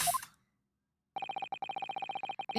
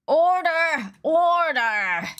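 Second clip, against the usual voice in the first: judge calling order; 8 semitones higher, 4 dB louder, much slower than usual. Acted. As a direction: shout it out, high and loud.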